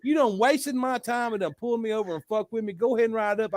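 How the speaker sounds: high-pitched indignant comeback; heated retort without shouting; retold mad refusal. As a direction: reenact yourself refusing to back down. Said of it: "Then I get mad".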